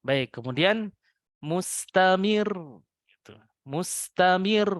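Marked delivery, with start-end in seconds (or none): 1.4-2.5 mustamir slow, voice up on the doubled ra; rising tone. Shown as a demonstration. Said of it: "Naik nadanya". 3.6-4.7 mustamir slow, voice up on the doubled ra; rising tone. Shown as a demonstration. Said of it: "Naik nadanya".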